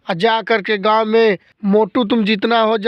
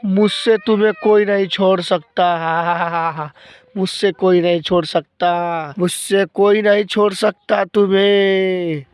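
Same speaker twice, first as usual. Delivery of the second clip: menacing ghost with a cackle. Acted. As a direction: gloat like a spooky ghost.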